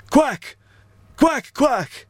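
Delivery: high, crisply spaced quacks; careful high-pitched duck talk; speaking duck language. Acted. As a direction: quack as if addressing ducks. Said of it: "I know a little of their language".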